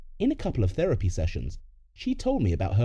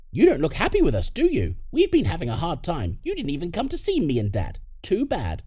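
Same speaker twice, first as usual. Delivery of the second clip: higher, scornful parent voice; a touch of villain, reproaching a child; lightly voiced menacing parent; 6 semitones higher than usual. Criminal parent reproaching the grown son, lightly menacing.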